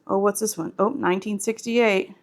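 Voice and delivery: usual speaking voice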